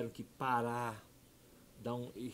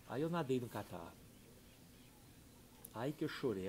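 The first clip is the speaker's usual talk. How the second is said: ordinary delivery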